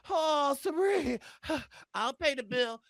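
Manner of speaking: soft, sighing mock offer; Ozzy impression. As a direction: imitate a smitten volunteer, softly with a sigh. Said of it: "Ozzy said".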